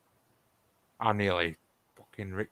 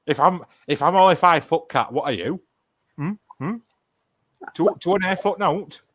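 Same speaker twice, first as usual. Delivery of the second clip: teasing retort; 6 semitones higher, 6 dB louder than usual. Real, not acted. Retorting to the five-foot jibe.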